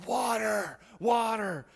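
high, loud mock begging for a drink; hammed-up cry of parched thirst; desperate plea. Thirsty cyclists reaching a restaurant, mock-desperate cry. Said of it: "just desperate for this water".

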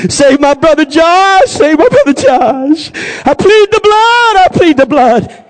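loud, high wailing plea, building and drawn out; desperate crying prayer. Retold or imitated. Boy begging God to save his family, acted desperate wail.

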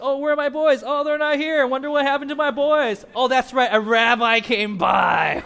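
high, loud mock lament for her lost boys; mock wailing mother. Mother calling for her missing sons, high and loud. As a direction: cry out like a frantic mother.

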